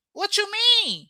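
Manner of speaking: high, sassy comeback; playful sass. Pretend. She teases viewers with a sassy high comeback.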